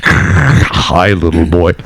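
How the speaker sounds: a hammed-up deep hello from inside a rabbit suit; deep costume greeting. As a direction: drop the voice way down to greet a little boy. [name] greets kid in costume.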